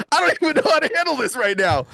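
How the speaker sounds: high-pitched retold helplessness; imitated frantic plea; overwhelmed panic. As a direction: act at a loss, voice pushed high. Acted desperate plea for help.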